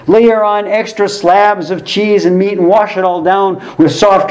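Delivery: hammed-up sarcastic sales push; sarcastic urging. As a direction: egg them on with exaggerated, ironic zeal. Sarcastic rant urging more excess.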